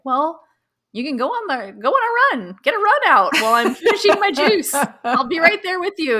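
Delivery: high sing-song, falsely sweet; sweet and cheerful. Retold or imitated. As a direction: cheerfully nudge them off. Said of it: "you can be like".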